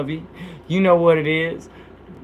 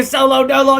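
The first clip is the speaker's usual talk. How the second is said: shouted name; 9 semitones higher, 4 dB louder than usual. Real, not acted.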